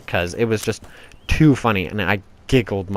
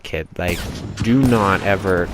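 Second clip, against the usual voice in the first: ordinary delivery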